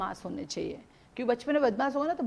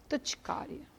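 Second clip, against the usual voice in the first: whispered dictation; 4 semitones higher, 9 dB quieter than usual. Shown as a demonstration. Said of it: "लिखो".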